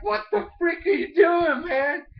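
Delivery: high, loud, put-on voice yelling at a partner; annoyed outburst. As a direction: snap at him after the crash. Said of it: "They're like".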